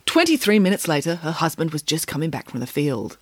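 ordinary delivery

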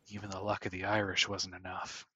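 low, soft grumble of defeat; lightly voiced downcast sailor, under the breath; dejected sailor. Game master voices a dejected sailor.

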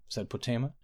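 ordinary delivery, nothing marked

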